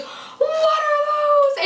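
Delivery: very high, very slow shouted taunt; drawn-out high mocking yell about his shoes; meme shout. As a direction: stretch it out like the meme.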